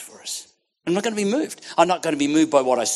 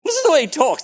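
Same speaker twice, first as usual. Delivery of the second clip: imitated amazed foreigners; 12 semitones higher, 8 dB louder than usual. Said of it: "They go".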